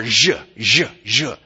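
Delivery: buzzy French-style J on each "zh"; repeated voiced "zh", each one set apart; Portuguese zh sound. Shown as a demonstration. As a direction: say "zh" as in the middle of "measure". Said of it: "Half of the sounds in that language".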